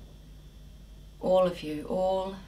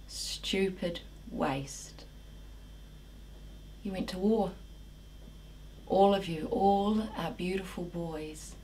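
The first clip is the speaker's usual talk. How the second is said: grieving monologue; 5 semitones lower than usual. Acted. Woman lamenting the boys lost to war, grieving. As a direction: mourn the boys who went to war.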